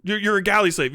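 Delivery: ordinary talk, nothing marked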